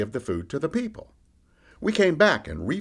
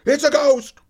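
high, loud alarmed yell; 14 semitones higher, 9 dB louder than usual. A narrator's reading. Frightened man on the boat yells a warning, high and loud. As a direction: yell it in panic, pitched right up. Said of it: "somebody yelled out".

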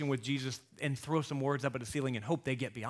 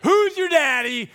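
much higher, louder, slow gloating crow; triumphant boast; 13 semitones higher, 12 dB louder, slower than usual. Retold or imitated. Dad proving his point to daughter, gloating boast. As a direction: crow over the win like a champion. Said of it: "I'm like".

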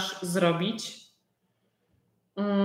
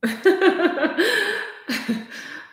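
high laugh; 10 semitones higher than usual. Real, not acted. Amused by learners.

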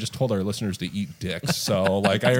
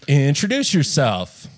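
drawn-out announcement; 4 semitones higher, much slower than usual. Pretend. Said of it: "mock host intro".

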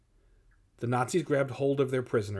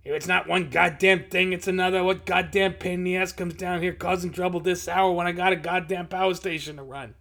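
higher, irritated muttered rant; swearing grumble; 6 semitones higher, 4 dB louder than usual. A narrator's reading. Supervisor stomping up to the door, grumbling curses. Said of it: "swearing under their breath".

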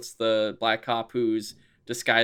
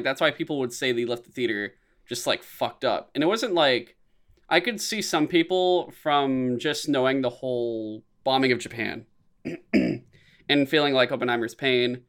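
normal talking voice

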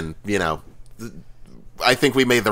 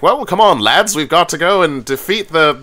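lightly voiced British butler, higher and louder at the start; a hint of a dutiful British butler rallying the lads; a touch of a reluctant English butler, half-hearted and brisk; butler-like British hero; 8 semitones higher, 4 dB louder than usual. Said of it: "he's just sort of like".

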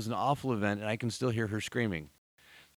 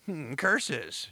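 hammy cartoon villain grumble; mustache twirling villain; 3 semitones higher, 4 dB louder than usual. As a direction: hiss it like a foiled villain. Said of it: "now I'm twirling my mustache".

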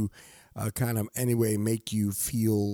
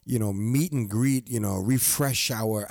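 ordinary talk

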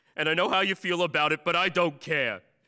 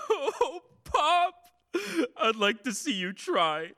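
high laughing through tears; tearful laugh; 8 semitones higher, slower than usual. Acted. Son laughs tearfully with father.

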